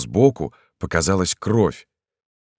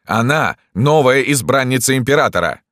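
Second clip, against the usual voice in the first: high, raised insistence; indignant shout; 7 semitones higher, 4 dB louder than usual. A narrator's reading. Dantes warning doctor about emperor's favourite, shouting. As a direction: cry it out, pitched up. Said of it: "кричал Дантес".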